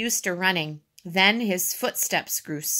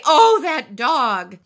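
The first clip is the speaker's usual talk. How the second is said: loud, slow exclamation; higher, drawn-out dismay; exasperated; 6 semitones higher, 9 dB louder, slower than usual. A narrator's reading.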